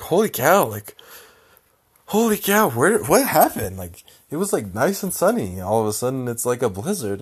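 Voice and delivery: high, loud mock astonishment; wide-swinging, high-pitched disbelief; mom's startled exclamation. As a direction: imitate mom waking up stunned by the weather. Mom wakes up to a blizzard.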